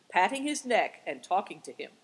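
plain reading voice

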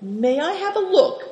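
high-pitched, polite little question; sweetly courteous, high request. A narrator's reading. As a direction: ask politely, pitched well up. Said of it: "asked Ma politely".

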